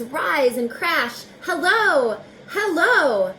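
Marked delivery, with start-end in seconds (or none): 1.4-3.3 loud high calls over the storm; calling out. Acted. Reader calling hello through the storm, loud. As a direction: shout it over crashing waves.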